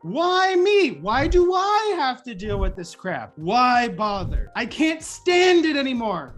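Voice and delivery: slow, loud, exasperated moaning; hammed-up defeated whine; mock despair. Shown as a demonstration. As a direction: groan each complaint out. Despairing self-talk example.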